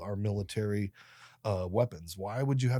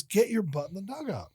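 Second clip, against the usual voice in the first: high-pitched imitation of a cross coach; retold coach's sharp command; stern coach; 10 semitones higher than usual. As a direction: order a player off, without shouting. Coach repeats the order, retold.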